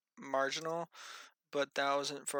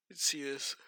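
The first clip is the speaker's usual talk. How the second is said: yawning. Real, not acted. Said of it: "I'm yawning".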